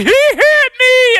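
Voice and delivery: high, slow, loud mock wail; tattling kid. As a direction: wail to the parents like an aggrieved child. Child tattling to parents.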